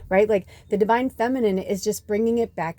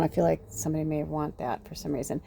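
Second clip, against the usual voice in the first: usual speaking voice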